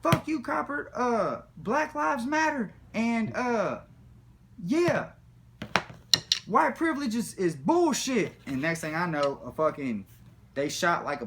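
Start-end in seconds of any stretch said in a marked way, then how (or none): 0.0-8.3 high, loud defiant shouting; mock defiant yell. Running from the cops, shouting high and loud. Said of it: "And I'm like".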